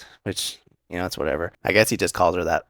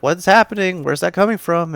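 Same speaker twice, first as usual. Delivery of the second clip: high, loud startled questions; hammed-up alarmed cry, pitched way up; mock alarm; 11 semitones higher, 6 dB louder than usual. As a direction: act out waking up in a panic. Characters startled awake by footsteps.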